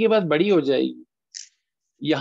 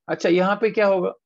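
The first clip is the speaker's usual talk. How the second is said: usual speaking voice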